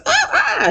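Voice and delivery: shrill squeal of alarm; high mock shriek. Heavenly slamming the door, high startled squeal. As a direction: yelp and shut the door. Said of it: "Heavenly's crazy ass".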